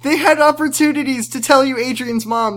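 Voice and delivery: high, loud, laughing protest; loud laughing rant. Acted. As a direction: shout it back through laughter. Laughing shout back.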